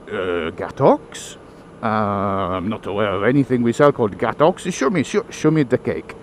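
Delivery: low, puzzled French shopkeeper; baffled Frenchman speaking accented English; caricature French accent. Acted. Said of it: "portrayal of French people".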